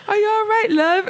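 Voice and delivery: high, kind British customer voice; lightly British, concerned and kind; British accent. Retold or imitated. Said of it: "she was British".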